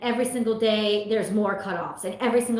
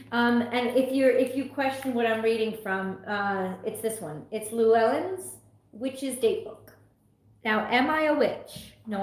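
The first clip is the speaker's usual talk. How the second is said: ordinary talk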